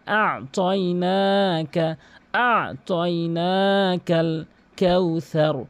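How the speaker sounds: slow আ তয়নাকাল কাউসার, না stretched one beat; measured recitation. Shown as a demonstration. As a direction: chant আ তয়নাকাল কাউসার slowly from a throaty আ. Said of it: "এক আলিফ টেনে পড়তে হয়". (আ তয়নাকাল কাউসার ধীরে, না টেনে)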